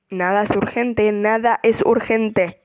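loud, insistent repetition; emphatic loud. Real, not acted. Insisting nothing is urgent.

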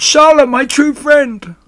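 high, loud wail of grief; anguished moan. A narrator's reading. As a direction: moan it out in despair. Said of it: "he moaned".

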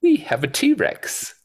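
high, slow proud imitation; mock Hammond. Friend imitating Hammond boasting to Grant, high and slow. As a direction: announce it slowly in a high put-on voice. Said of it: "And he goes".

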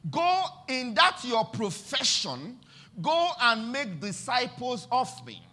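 rhythmic sermon cadence, rising and falling; preaching. Acted. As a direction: preach it with steady conviction.

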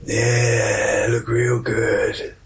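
sleazy, slowing American impression; Bill Clinton. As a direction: leer it out like a creepy American politician. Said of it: "Don't like your weird accent".

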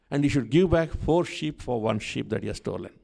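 ordinary talk, nothing marked

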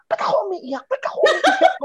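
high, laughing, asking herself why she cries; giggling through tears at herself; laughing self-talk. Retold or imitated. Retelling her confused crying at communion, laughing. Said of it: "sabi ko sa sarili ko".

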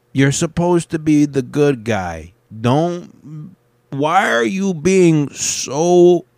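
high, drawn-out appeal; exasperated plea. Pretend. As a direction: plead slowly, stretching the words up high. Mock plea addressed to Jeff Bezos.

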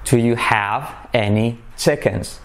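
deliberate teacher's enunciation; slow model. Shown as a demonstration. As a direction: separate each word of "Do you have any chickens". Slow model, then natural repeats.

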